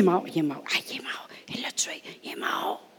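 hushed whisper. Acted. The crowd whispering that the boy is dead. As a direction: whisper it in shock.

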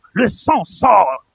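very slow, stretched pulpit cry; drawn-out emphatic repeat. Real, not acted. Preacher dwelling on the flowing blood, drawn-out emphasis. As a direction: stretch each word out forcefully.

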